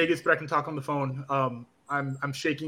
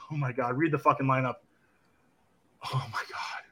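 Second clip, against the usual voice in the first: whispered in awe; whispering at the lineup; awed whisper; 3 semitones lower, 6 dB quieter than usual. Real, not acted. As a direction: breathe it out, stunned.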